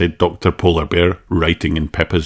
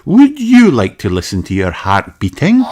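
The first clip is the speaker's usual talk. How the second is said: high, friendly doctor; kindly doctor greeting a child; Doctor Polar Bear voice; 8 semitones higher, 5 dB louder than usual. Acted.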